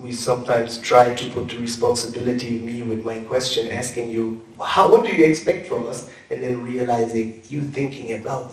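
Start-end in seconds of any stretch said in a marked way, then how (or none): none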